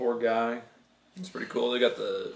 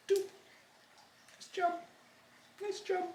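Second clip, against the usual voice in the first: 1 squeaky praise; 10 dB quieter, faster than usual. Acted. Dad praises in silly voice.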